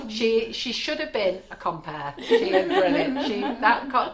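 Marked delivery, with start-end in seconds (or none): 2.2-4.1 high, loud burst of laughing praise; real laughter breaking into the words. Real, not acted. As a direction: gush it while cracking up. Laughing about colleague.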